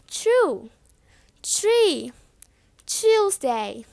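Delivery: "Tree" begun as chree; CH sound. Shown as a demonstration. Teacher showing TR common across UK, CH sound. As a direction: start "Chew" and "Tuesday" with ch. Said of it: "The C H sound".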